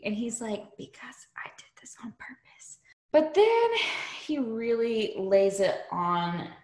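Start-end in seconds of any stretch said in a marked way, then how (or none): 0.8-2.8 sly hushed whisper, quick. Retold or imitated. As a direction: breathe it out like a secret plan. Sneaky villain line whispered.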